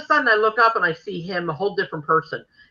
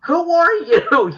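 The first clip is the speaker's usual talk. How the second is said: high, slow, stunned question; gasping who are you at a stranger; shocked disbelief; 10 semitones higher, 6 dB louder, much slower than usual. Retold or imitated. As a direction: stretch it out in disbelief. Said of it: "And I'm like".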